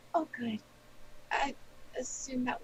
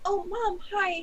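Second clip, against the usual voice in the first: a touch of higher, louder relief; excited greeting; 7 semitones higher, 5 dB louder, slower than usual. Acted. Daughter greets her mother with mild excited relief. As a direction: lightly brighten up at hearing Mom.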